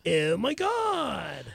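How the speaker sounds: slow, drawn-out valley-speak; valley boy. Shown as a demonstration. Rapid-fire accent request from host, valley boy. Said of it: "Valley boy".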